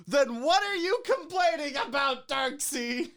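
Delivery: high, loud mock whine. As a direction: ham up a snooty reviewer. Mocking the reviewer's voice.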